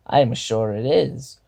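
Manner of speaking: a lightly voiced kobold general, smooth and sly; hint of a sly creature leader toying with a guest. A narrator's reading. Kobold general slyly questioning the chosen one.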